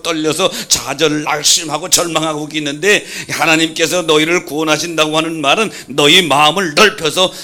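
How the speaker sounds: loud, rising preacher's cry; preaching. Real, not acted. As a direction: thunder it from the pulpit. Preaching at full force.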